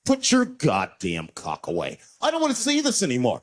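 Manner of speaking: loud, suddenly brave outburst; mock brave passenger yell; emboldened passengers. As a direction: pile on like a newly brave crowd. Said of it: "now they're brave".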